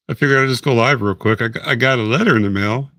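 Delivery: usual speaking voice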